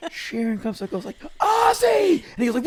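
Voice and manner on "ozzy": high, loud call of his name; retold shout, high and loud; mock shout. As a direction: yell for him across backstage. [name] calls [name] sharply.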